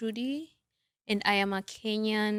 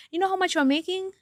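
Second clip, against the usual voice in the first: teasing high question; 10 semitones higher, 4 dB louder than usual. Real, not acted.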